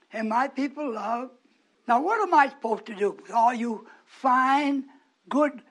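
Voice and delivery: ordinary talk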